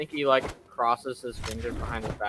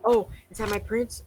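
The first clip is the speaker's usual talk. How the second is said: put-on higher girlish voice; dreamy Fiona; 9 semitones higher than usual. Fiona hearing her rescuer, high girlish voice. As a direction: swoon it like a princess. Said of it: "she's like".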